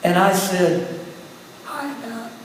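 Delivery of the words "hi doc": a barely there croak, much softer; a ruined voice that can hardly get out a greeting. Retold or imitated. Pastor greeting his doctor in a much softer, broken croak. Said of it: "my voice was exactly the same".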